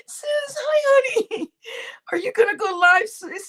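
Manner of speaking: high, teary-laughing hello; excited greeting. Real, not acted. Greeting sis joining the stream, high laughing squeal.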